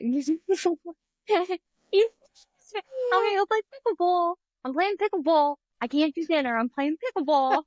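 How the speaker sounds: put-on high, brash New York accent; hammy New York accent, pushy and quick. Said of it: "but in a New York accent".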